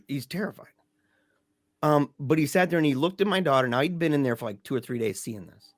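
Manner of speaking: ordinary talk, nothing marked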